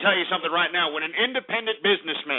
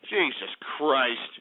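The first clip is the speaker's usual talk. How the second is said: ordinary delivery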